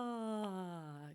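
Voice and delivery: open-throated yawn sighed out. Shown as a demonstration. Vocal coach showing a yawn sigh to warm up. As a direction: let the sigh fall out through a big yawn. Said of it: "Exhale, sigh".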